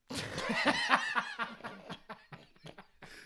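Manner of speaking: squealing burst of laughter; high laugh. Real, not acted. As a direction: let out a high giggle. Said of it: "He caught me, y'all".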